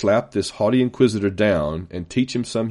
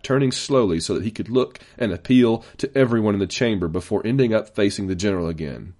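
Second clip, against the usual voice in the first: plain reading voice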